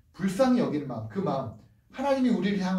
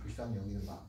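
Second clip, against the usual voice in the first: much lower and much softer; hushed echo; 10 semitones lower, 13 dB quieter, faster than usual. Shown as a demonstration. Preacher softly repeating the phrase to the church. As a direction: breathe the phrase out gently.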